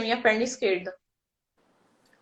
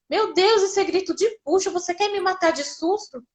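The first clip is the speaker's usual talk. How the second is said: alarmed doctor; 8 semitones higher than usual. Retold or imitated. Doctor runs in, startled by the pushing scream, higher alarmed voice.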